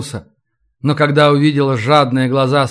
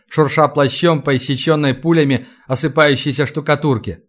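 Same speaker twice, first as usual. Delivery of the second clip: ordinary delivery, nothing marked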